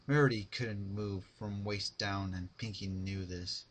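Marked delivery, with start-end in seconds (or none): none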